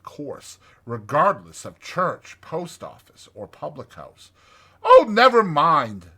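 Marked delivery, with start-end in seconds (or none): 4.8-6.1 much higher and louder breezy brush-off; cheerful Rat. A narrator's reading. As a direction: wave the worry away brightly. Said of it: "said the rat".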